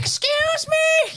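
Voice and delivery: piercing, drawn-out high screech; loud, whiny shriek, far above normal pitch; Vicky Guerrero. Retold or imitated. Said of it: "that's what she sounds like".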